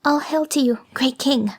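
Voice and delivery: put-on the shivering girl greeting King Frost politely; gentle, trembling. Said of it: "in a gentle, trembling voice".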